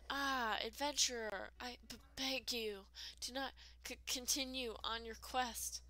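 halting plea broken by repeated stutters; hammed-up stammering warning to an adventurer; stammering frightened game character.

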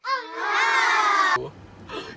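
high loud sob gasp; crying gasp. Real, not acted. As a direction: break into a tearful gasp. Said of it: "She's actually crying".

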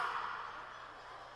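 sharp unvoiced gasp after a scream; gasping breath. A sobbing child catching his breath. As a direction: suck air in like a sobbing kid.

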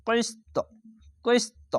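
high bouncing qalqalah on কিস্ত; qalqalah bounce. Shown as a demonstration. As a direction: kick the ত of কিস্ত with a jolt. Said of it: "এভাবে উচ্চারণ হবে".

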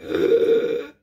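a loud airless gasp, as if choking; imitated choking gasp. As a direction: rasp in a desperate choking breath. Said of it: "it's horrible sounds".

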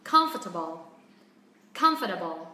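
"comfortable" strong on COM then sliding down low; "comfortable" with a clear low fall after COM; stressed start, falling voice. Shown as a demonstration. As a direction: hit COM, drop the voice through "-fortable". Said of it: "chúng ta sẽ xuống giọng".

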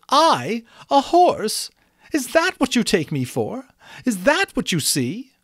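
high, affronted questioning; indignant demand. A narrator's reading. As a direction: demand an answer in piercing disbelief. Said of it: "she demanded".

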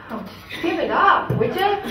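mock exasperation, rising up at the end; exasperated teasing. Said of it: "we were like".

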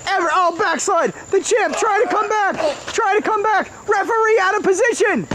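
wrestling commentator. Acted. Continues play-by-play.

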